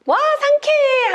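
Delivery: very high, drawn-out cry of relief; exhilarated exclamation. Acted. Giraffe breaking out of the frame, exhilarated exclamation. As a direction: stretch it out high, bursting free.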